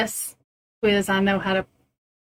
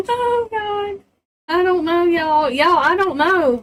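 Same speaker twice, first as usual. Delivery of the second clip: laughing; 8 semitones higher than usual. Real, not acted. Laughing fit, very high.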